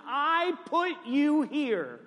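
high, drawn-out proclamation; emphatic divine declaration. Acted. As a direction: proclaim it slowly, pitched high. God's emphatic declaration to Jeremiah.

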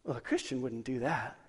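low, soft judgmental mutter; put-on nasally disapproval; snarky, nasally. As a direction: imitate your sanctimonious younger self. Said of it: "I didn't sound nasally like that".

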